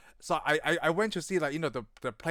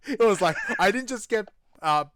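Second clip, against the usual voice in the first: higher and louder, laughing through the words; laughing quote; 4 semitones higher, 5 dB louder than usual. Retold or imitated. Recounting the police chief's rant to a friend, laughing. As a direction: giggle out the chief's line.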